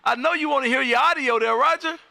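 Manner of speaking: ordinary delivery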